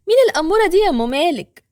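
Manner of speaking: neighbor woman's voice. Acted. Neighbor woman asks about the girl.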